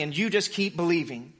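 normal talking voice

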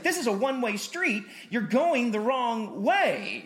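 loud, high, slowing on the wrong way; driving examiner yelling at the teen driver; instructor yelling. Retold or imitated. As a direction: shout it like a nervous examiner. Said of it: "then he just yelled at me".

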